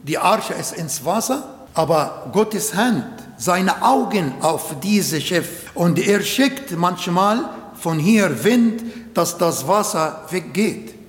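ordinary talk, nothing marked

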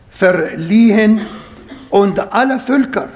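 normal talking voice

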